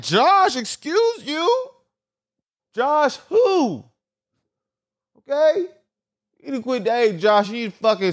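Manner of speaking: high, indignant excuse-you voice; sassy tell-off. Retold or imitated. Scolding Josh the telemarketer, high sassy indignation. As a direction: put him in his place with attitude. Telling off the caller.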